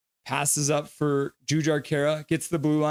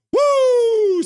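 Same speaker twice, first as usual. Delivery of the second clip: shouted woo; 8 dB louder than usual. Retold or imitated. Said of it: "says Aiden Kelly".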